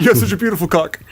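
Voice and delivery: clipped, awkward movie line; quoted film clip. Retold or imitated. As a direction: say it like an edited clip. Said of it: "It's so funny 'cause it's clipped".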